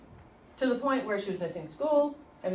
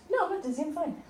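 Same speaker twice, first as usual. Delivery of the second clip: daughter's breezy reply; 8 semitones higher than usual. Retold or imitated. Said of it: "She's like".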